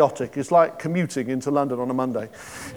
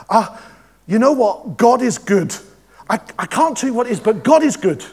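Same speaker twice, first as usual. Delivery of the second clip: higher and louder, bursting with joy; joyful testimony; 7 semitones higher, 5 dB louder than usual. Retold or imitated. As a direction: gush it out like good news you can't contain. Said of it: "She goes".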